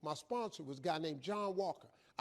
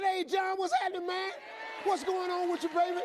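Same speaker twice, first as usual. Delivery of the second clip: loud, high, exuberant hello to a buddy; hammed-up morning enthusiasm; overeager greeting; 11 semitones higher, 4 dB louder than usual. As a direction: greet him bursting with wide-awake energy. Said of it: "with the right attitude".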